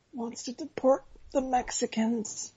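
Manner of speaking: soft, high and slow; hushed plea. Real, not acted. Co-host objecting to host's shrug about Trump, hushed plea. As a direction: keep it small, high and halting.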